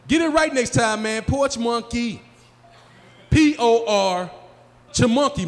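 high, loud indignant correction; mad friend. Acted. Friend correcting comic on his nickname, mad. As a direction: spell it out in mock outrage. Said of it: "he'll get mad you call him anything else".

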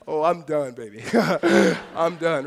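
chuckling disbelief between laughs; an old woman laughing at the news. Retold or imitated. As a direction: laugh off the idea of a baby.

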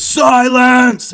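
booming, high commanding shout; Dumbledore. Acted. Wizard silencing a whole room in Harry Potter, loud hammed-up shout. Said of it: "one of the scenes in Harry Potter".

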